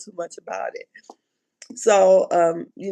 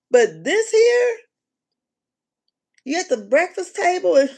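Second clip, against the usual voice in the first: exasperated; 9 semitones higher than usual. Real, not acted. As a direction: drag it out in disbelief. Exasperated about crying at breakfast.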